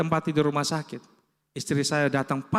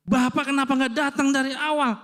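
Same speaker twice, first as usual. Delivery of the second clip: much higher-pitched telling-off, imitating the doctor; retold scolding; 8 semitones higher than usual. Doctor to patient who came in late, retold scolding. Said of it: "Dia marahin".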